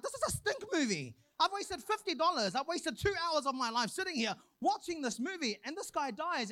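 high, indignant grumble about a movie; mock complaint. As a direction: whine like a cheated moviegoer. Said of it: "you're like".

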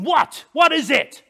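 sharp, loud, high demand; snapped. A narrator's reading. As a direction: snap it at the staring priests. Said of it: "I snapped at them".

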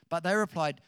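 ordinary talk

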